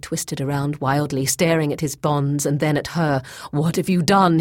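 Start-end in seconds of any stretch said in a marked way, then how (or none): 3.5-4.4 louder, mildly alarmed cry. A narrator's reading. Boyce, waking bound to stakes, to Grey Alis, mildly alarmed cry. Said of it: "he cried".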